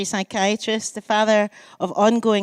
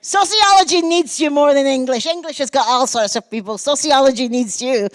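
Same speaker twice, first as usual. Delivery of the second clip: louder, higher pleading recruiter; pleading professors; 5 semitones higher, 5 dB louder than usual. Retold or imitated. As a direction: beg her loudly to stay in sociology. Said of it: "they said".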